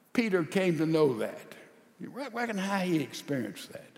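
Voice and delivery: ordinary delivery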